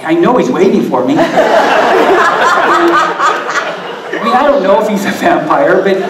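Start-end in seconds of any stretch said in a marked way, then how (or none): 1.2-4.0 high burst of laughter. Real, not acted. Telling the climbing crowd about the bat, high laughter. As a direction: laugh high and free at your own story.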